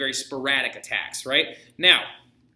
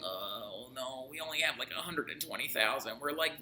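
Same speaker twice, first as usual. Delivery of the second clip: mock dismay, much softer; half-whispered oh no, voicing the outnumbered Russians; dismayed hush; 3 semitones lower, 12 dB quieter than usual. Russians realize they're outnumbered.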